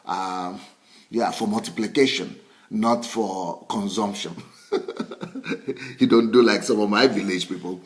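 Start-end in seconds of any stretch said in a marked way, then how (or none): 4.7-5.9 amused burst of chuckling; a short, breathy run of ha-has; chuckle. Real, not acted. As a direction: laugh lightly at your own remark. Chuckles at own remark.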